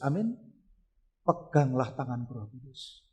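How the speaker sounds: normal talking voice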